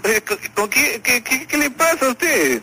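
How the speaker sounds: high, stammering indignation; indignant. Real, not acted. Accused man to prank caller, indignant. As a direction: stumble on the question, then stretch it out high.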